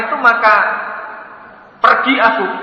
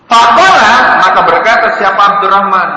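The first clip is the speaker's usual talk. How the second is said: raised, animated narration; lantang bercerita; 4 semitones higher, 8 dB louder than usual. A narrator's reading. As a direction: call it out to the crowd. Ustaz menceritakan dengan semangat. (narasi lantang penuh semangat)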